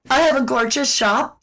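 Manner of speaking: ordinary talk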